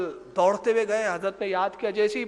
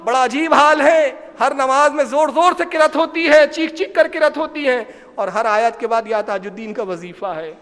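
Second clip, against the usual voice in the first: loud, higher-pitched complaint to the master; complaining loudly; 5 semitones higher, 6 dB louder than usual. Retold or imitated. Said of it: "شکایت کرتے".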